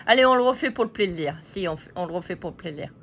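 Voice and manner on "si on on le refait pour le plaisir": ordinary talk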